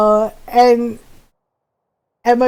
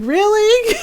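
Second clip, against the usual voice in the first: high-pitched, rising "really?"; mock incredulity; 8 semitones higher than usual. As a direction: imitate an affronted brother in disbelief. Brother astonished that mom laughs.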